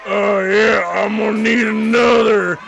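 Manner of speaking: put-on loud drunken bellow; drunk shout. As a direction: bellow for another drink. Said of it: "he just goes".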